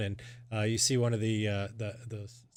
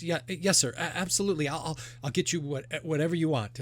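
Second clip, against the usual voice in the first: put-on high, stammering kid eager to please; eager young server; 7 semitones higher, faster than usual. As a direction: stumble over it as a young server would. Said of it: "She goes".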